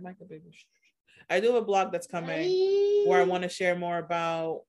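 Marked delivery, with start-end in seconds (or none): 0.0-0.5 hushed parental aside. Real, not acted. Podcaster interrupted by a child mid-answer, hushed aside. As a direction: drop right down, away from the microphone.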